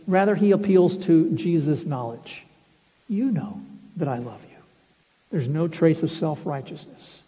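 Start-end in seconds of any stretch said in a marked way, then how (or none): 3.1-4.6 humble reply. Retold or imitated. Humble Peter answer, contrasted.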